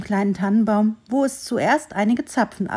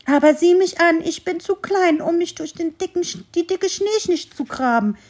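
high, louder pleading squirrel voice; desperate squirrel; 7 semitones higher, 5 dB louder than usual. A narrator's reading. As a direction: plead in small despair. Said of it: "Das Eichhörnchen war ehrlich verzweifelt". (verzweifeltes Eichhörnchen, hoch und lauter)

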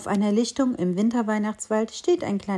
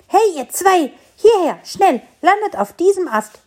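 high, loud call across the sky; 9 semitones higher, 7 dB louder than usual. A narrator's reading. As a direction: wave the birds over, calling. Tree calls birds over.

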